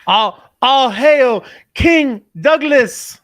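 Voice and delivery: loud, high, drawn-out hail; mock royal acclamation. Co-host hails the arriving guest as king, mock proclamation. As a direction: proclaim it slowly like a playful herald.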